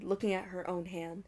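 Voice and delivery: ordinary delivery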